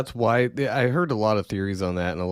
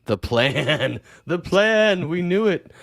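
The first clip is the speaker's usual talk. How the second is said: mock Q fan delight; 9 semitones higher, 4 dB louder, much slower than usual. Mocking Q crowd.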